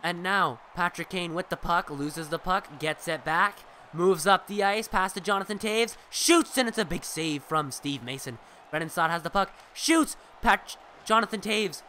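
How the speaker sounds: brisk sportscaster call, rising on the shots; live play-by-play. Acted. Streamer calling the hockey game for his viewers, lively play-by-play.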